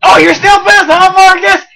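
high, shouted challenge; loud taunt. Real, not acted. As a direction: taunt the companion at full voice. Taunting angry companion in fight.

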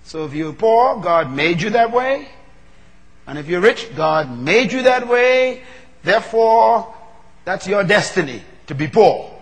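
insistent sermon cadence; forceful preaching. Retold or imitated. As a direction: press each line of the argument home. Preacher voicing his angry reasoning.

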